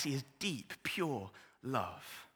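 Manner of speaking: ordinary delivery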